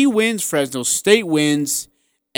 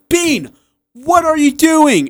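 high, slow, incredulous yell; a coach who can't believe his eyes; exasperated coach; 12 semitones higher, 4 dB louder, slower than usual. Retold or imitated. Coach calling to his lost player, high and exasperated. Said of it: "Craig looks over and goes".

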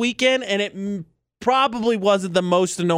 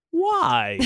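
cheeky why; 13 semitones higher than usual. Retold or imitated. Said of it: "I immediately responded with".

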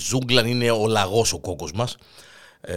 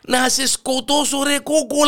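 high, strained threat; pained fury; 13 semitones higher, slower than usual. Acted. Lion in agony threatening the hare, pained fury. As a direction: wail the threat in a high agonised voice.

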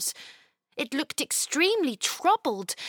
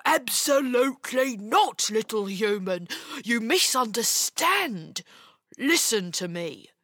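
insistent creature voice; urgent, frightened ogre; 2 semitones lower than usual. A narrator's reading. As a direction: cut in to correct a misunderstanding. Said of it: "The ogre seemed urgent and frightened".

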